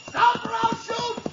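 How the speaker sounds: high ringing shout after the sleigh; shouting stop. Acted. Cop chasing a runaway sleigh, shouting. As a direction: yell it from down the street.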